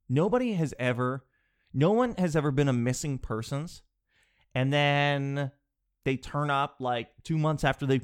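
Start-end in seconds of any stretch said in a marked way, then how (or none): none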